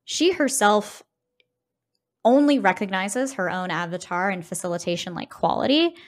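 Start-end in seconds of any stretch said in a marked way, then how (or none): none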